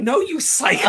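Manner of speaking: high, loud playful squeal of protest. Retold or imitated. Tabitha fending off Jubilee's suplex, squealing high. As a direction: shriek it at a friend pinning you. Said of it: "she's like".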